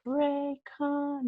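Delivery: slow, high robotic announcement voice; imitating the earbud voice. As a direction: mimic the device saying its brand name slowly. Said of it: "That's what comes on".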